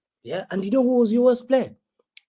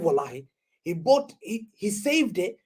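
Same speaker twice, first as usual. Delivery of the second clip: ordinary delivery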